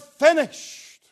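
high, loud pulpit proclamation. Retold or imitated. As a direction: declare it as a triumphant single word. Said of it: "his voice rises up and he declares".

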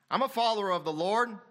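high, loud imitation of a professing believer; mock proclamation. Preacher voicing a hypocrite to the church, mock proclamation. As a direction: announce it loudly as a hypocrite would.

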